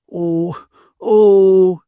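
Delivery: high, loud wailing sobs; sobbing. A narrator's reading. Ferguson breaks down at being left, acted sobbing. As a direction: let the sobs burst out. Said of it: "she sobbed".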